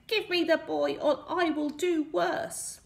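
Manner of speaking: high, loud squeaky villain; threatening imp. A narrator's reading. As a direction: menace them in a high little voice.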